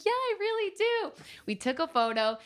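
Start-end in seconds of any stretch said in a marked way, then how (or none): 0.0-1.1 eager yes. Retold or imitated. Eager reply to photo offer.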